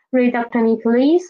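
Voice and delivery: usual speaking voice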